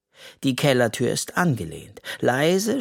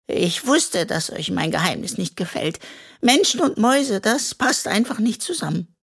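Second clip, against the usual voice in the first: sad; 8 semitones higher than usual. A narrator's reading. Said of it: "rinnt eine dicke Träne".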